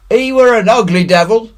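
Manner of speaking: high, loud frightened pirate cry; scared pirate shudder. A narrator's reading. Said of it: "cried a third pirate with a shudder".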